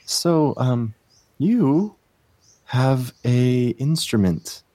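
sing-songy dryad. Acted. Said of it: "in a sing-songy voice".

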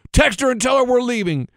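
high mock bossy kid; five-year-old giving orders. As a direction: imitate a small child commanding dad. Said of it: "He goes".

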